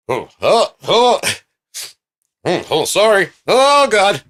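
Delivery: high, loud flustered exclamations; put-on high voice, mock distress; flustered screen character. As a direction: play an overdramatic startled film character. Acts figure on screen.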